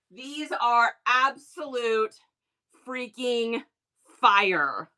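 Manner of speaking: ordinary delivery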